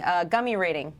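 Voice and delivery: ordinary delivery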